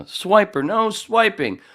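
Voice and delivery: loud, high cartoon call; playful high-pitched scolding; cartoon catchphrase. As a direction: shout it like a kids' show heroine. Quotes a children's cartoon catchphrase.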